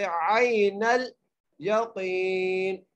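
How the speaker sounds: very slow इल्मल यकीन, ain joined to lam; Quranic recitation. Shown as a demonstration. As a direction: draw out यकीन. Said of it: "बगैर खींचे पढ़ना है".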